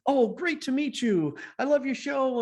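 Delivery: high, gushing born-salesman greeting; confident salesman. Retold or imitated. Confident intern greeting the producer, acted as a high, gushing salesman. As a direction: greet them smoothly, pitched well up. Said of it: "tall and handsome and smart and confident".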